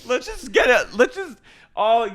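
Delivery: high, loud, fast pleading; drunk Miranda. Retold or imitated. Miranda rallying the girls for wine, loud fast pleading. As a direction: rope everyone into the bottle. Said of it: "She goes".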